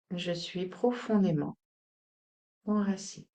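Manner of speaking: subdued, grounded mantra; quiet affirmation. Shown as a demonstration. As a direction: let it sink in gently.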